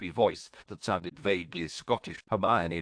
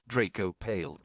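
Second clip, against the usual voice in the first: ordinary delivery, nothing marked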